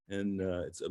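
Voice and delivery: usual speaking voice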